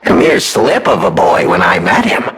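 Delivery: ordinary delivery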